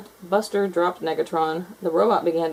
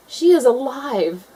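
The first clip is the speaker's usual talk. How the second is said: slow amazed; 6 semitones higher, 5 dB louder, slower than usual. A narrator's reading. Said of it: "said Miss Pickles".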